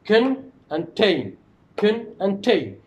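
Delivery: kin and tain, each syllable separate and slow; split into syllables. Shown as a demonstration. Teacher splits contain into syllables for the class. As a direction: kin and tain, spaced out with a pause between. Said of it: "two syllables".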